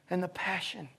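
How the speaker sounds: usual speaking voice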